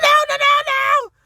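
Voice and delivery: very high loud no's; very high loud panicked protest; panicked shout. Retold or imitated. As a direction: yell in horror. Screaming at sneezing toddler.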